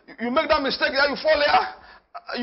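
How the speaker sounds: usual speaking voice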